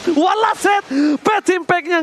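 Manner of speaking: fighting game announcer. Real, not acted. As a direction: yell the final hit like a finisher. Hype call on final blow.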